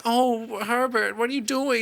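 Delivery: high, whiny cartoon dad; cartoon dad Peter whining high-pitched at his creepy neighbor. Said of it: "He was like".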